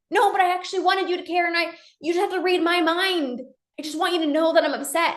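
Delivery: high, hurried mock complaint; pleading protest. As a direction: protest like a misunderstood partner, words tumbling out. Acted protest in couple's argument.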